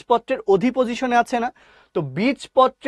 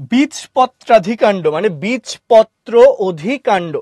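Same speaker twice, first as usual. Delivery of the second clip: বীজপত্রাধিকাণ্ড then বীজপত্র অধিকাণ্ড, slow and a bit louder; slow breakdown; 5 dB louder, slower than usual. Shown as a demonstration. Teacher breaking the term down, slow and firm. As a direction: split the word apart slowly. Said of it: "যদি শব্দটাকে ভেঙে বলি".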